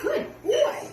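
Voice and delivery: high cooing praise. Real, not acted. Praising the parrot warmly.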